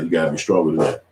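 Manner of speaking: normal talking voice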